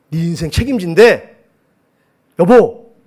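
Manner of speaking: loud, high burst to wake your wife; excited announcement. Retold or imitated. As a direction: re-enact breaking big news, drawn out and loud. Retells waking wife with big news.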